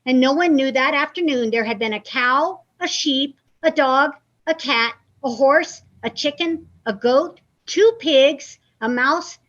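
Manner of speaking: lively, expressive picture-book reading; reading to children. Acted. Librarian reading aloud to young children, lively storytime voice. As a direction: read it like story time for little kids.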